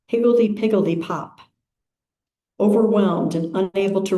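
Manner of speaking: unhurried, measured phrases with long pauses; calm, spacious meditation reading; guided meditation. Acted. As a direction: lead the congregation into stillness, phrase by phrase.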